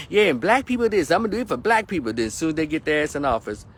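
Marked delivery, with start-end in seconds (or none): 0.1-2.1 campaigning politician. Retold or imitated. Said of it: "they say".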